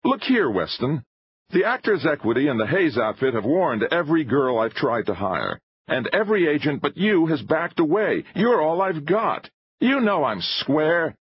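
high-pitched, urgent pleading pressure; heated filmmaker pressing his case; exasperated showman. A narrator's reading. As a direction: argue insistently, pitched well up.

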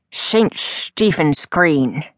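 slow, sing-song Irish brogue; a lightly voiced Irish carriage driver, lilting. A narrator's reading. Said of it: "he said in a lilting brogue".

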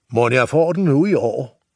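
high, loud mocking shout. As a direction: butcher shouting at Nis, sarcastic. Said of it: "fortsatte slagteren sit råberi".

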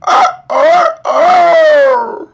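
loud, high rooster crowing; piercing cock-a-doodle cry. A narrator's reading. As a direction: crow at full volume like a rooster. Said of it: "let out a crow".

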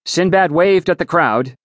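ordinary delivery

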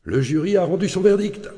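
high-pitched cry to the whole office; bawled announcement. A narrator's reading. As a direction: call out the big news in a strained, high voice. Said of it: "puis brailla".

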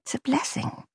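breathy high near-whisper; defensive whisper to boyfriend. Acted. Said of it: "she asked him defensively".

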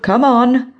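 bright high coaxing call; high, upbeat invitation; upbeat, cheerful. A narrator's reading. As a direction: cheerfully coax the girl over. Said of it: "in an upbeat, cheerful tone".

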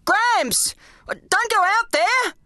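high, slow desperate scream; screaming warning. A narrator's reading. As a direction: yell a frantic warning. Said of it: "I screamed".

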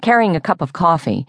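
ordinary delivery, nothing marked

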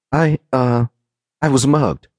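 stall, then offer a made-up excuse; unsure fib with a pause after the filler; hesitant lie. A narrator's reading. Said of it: "she lied".

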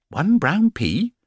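slow, high, rising echo of the words; high slow bewildered question; puzzled surprise. Acted. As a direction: repeat it back, baffled. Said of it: "repeated Paddington in surprise".

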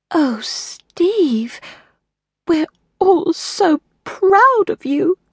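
high, slow, halting tearful voice; tearful stammer. Acted. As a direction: stammer it, close to tears. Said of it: "the Queen stammered, a bit tearful".

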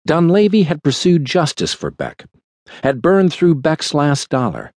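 usual speaking voice

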